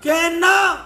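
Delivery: a furious nun's challenge; indignant shout. Acted. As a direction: snap it back at the drunk. Nun and drunk argue.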